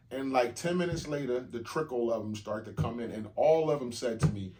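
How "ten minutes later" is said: ordinary talk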